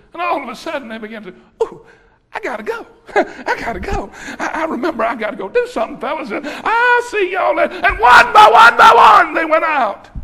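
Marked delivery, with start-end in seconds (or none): 1.6-7.7 sheepish accusers. Acted. Accusers slinking off, voiced.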